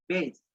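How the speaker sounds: বেসড said as "beist", final sound a clear t; বেসড with the ending ed spoken as t, no extra vowel; বেসড pronounced with a crisp t at the end; based with a t ending. Shown as a demonstration. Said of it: "এখানে দেখো আমি উচ্চারণ করেছি বেসড".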